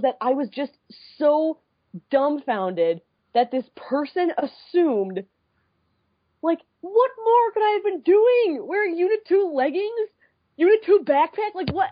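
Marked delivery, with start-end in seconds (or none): 6.4-11.9 higher, incredulous rant; can't believe he doubted her fandom; exasperated. Real, not acted. Retelling the fan's challenge to friends, exasperated and higher.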